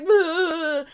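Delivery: high, loud smothering "ugh" noise; mock clingy noise. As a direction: imitate slobbering all over someone. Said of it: "and just, like".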